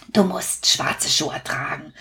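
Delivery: hushed, breathy secret tip; a secret whispered to the customer; whispered advice. Acted. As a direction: lean in and whisper it. Shoemaker gives whispered advice.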